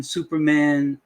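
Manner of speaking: ordinary talk, nothing marked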